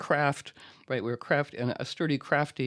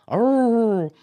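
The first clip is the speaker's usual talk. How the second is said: loud, high wolf-like howl; a rally-the-team howl; 9 semitones higher, 9 dB louder than usual. Pretend. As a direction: let out a big playful howl. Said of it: "Let's howl".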